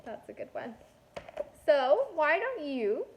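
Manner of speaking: ordinary delivery, nothing marked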